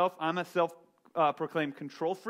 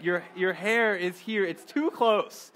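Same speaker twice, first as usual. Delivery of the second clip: cramped annoyance; 3 semitones higher, 4 dB louder, slower than usual. Retold or imitated. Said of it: "It's like".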